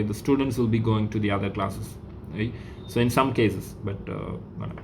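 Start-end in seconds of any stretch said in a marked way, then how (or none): none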